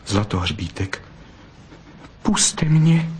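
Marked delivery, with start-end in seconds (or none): 2.2-3.1 pleading. A narrator's reading. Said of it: "zaprosila Bystrouška".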